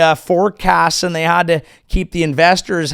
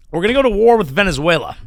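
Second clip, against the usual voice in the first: ordinary talk, nothing marked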